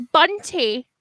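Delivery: ordinary talk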